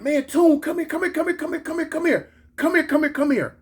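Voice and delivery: high-pitched, insistent calling; imitated urgent holler. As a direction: call someone over urgently, words tumbling in repetition. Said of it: "one of the homegirls hollered".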